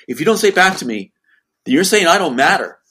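loud, high, worked-up ultimatum. Retold or imitated. Sister pressuring her brother on the phone, manic escalation. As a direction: imitate someone escalating into manic insistence. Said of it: "Then she gets more manic".

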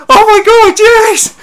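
high, loud squeal of mock excitement; hammed-up fangirl screaming; overexcited fan. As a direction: imitate an overexcited fan shrieking with glee. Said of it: "insanely overexcited at the Deadpool two trailer".

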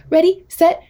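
high, playful race-start call; animated countdown. Shown as a demonstration. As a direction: build up to a big high "go". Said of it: "it's really animated".

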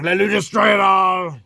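loud mock-epic declaration; shouting. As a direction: belt it out like a battle cry. Dramatic self-hyping outburst.

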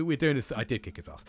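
ordinary delivery

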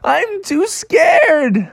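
very high, slow, tearful whimper; high tearful frog voice; scared crying frog. Acted. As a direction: whimper it out, terrified. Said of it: "Froggy's like".

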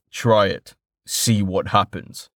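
calm deep-voiced man. A narrator's reading. Merchant daring the bandit, deep and calm. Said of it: "said the deep-voiced man calmly".

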